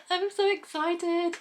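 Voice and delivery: high slow giddy laugh-talk; giddy excitement. Real, not acted. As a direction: gush with breathless joy. Laughing after arrival.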